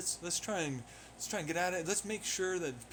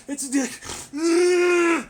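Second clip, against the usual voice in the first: laughing; 9 semitones higher, 13 dB louder than usual. Real, not acted. Breaks into laughter mid-scream.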